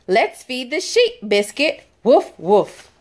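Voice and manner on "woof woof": playful barking woof; cheerful puppy woof for kids; dog bark. Pretend. Dog barks in picture book.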